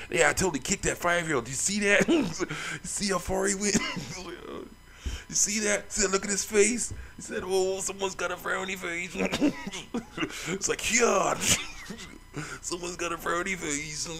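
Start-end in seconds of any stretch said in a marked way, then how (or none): 0.1-6.9 high, giddy crowing through laughs; gleeful laughing. Real, not acted. Gamer replaying his kick on a five-year-old, gleeful laughing. Crowing over kicking kid in game. 7.4-14.1 put-on high, giggly baby-talk taunt; teasing mock pity. Player taunting kicked child, giggly mock pity.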